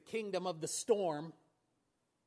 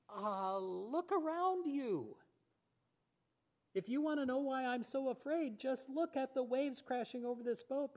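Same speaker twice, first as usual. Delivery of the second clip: higher, incredulous protest; panicked disciple; 6 semitones higher than usual. Retold or imitated. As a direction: point at the waves in disbelief. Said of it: "I can imagine the disciples going".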